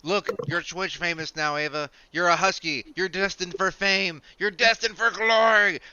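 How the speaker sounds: high, loud gushing to the dog; excited dog praise. Real, not acted. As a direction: hype up the husky like a star. Hyping the husky on stream.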